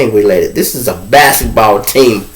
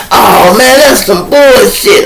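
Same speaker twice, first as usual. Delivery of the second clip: high, louder, slow grumble; grumbling inmates; 10 semitones higher, 4 dB louder, slower than usual. Retold or imitated. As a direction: drag it out in disappointment. Inmates grumble about being left.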